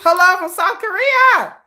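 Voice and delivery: mock Korean viewer, high and sing-song; mock foreign commenter. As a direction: squeak it up like a fan from abroad. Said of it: "It's just".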